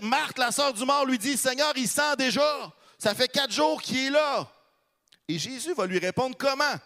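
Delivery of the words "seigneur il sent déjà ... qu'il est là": Martha objecting. Retold or imitated. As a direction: blurt it out, alarmed and blunt. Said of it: "Marthe va dire".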